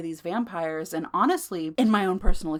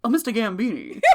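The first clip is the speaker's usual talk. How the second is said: movie judge impression. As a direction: imitate the film judge, on the edge of laughter. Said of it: "My Cousin Vinny".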